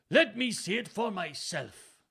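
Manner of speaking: high, loud, slow Welsh insistence; careful Welsh accent. A narrator's reading. Father insists on seeing letter on second try, careful Welsh accent. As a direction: insist in deliberate stage Welsh. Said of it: "insisted in a perfect accent".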